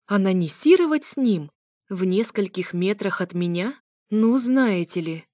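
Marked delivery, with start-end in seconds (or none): none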